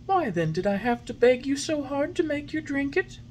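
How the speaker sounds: high, gentle fairy voice; fairy, patient. A narrator's reading. Fairy patiently coaxing the puppet. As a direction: soothe him kindly.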